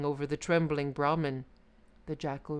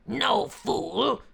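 loud, angry tiger; tiger shout; 6 semitones higher, 6 dB louder than usual. Acted. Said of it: "shouted the tiger".

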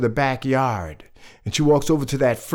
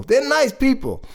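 higher-pitched, insisting they are nice; insistent; 9 semitones higher than usual. Acted. Storyteller reassures the children about the neighbours, insistent. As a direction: lift it high, as if defending them.

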